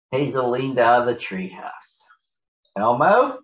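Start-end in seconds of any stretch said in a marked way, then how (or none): 2.7-3.4 a louder call upward. Acted. Hazel leaning out, calling to Elmo below. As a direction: call out from the treehouse. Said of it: "she called".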